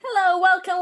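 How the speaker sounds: ordinary delivery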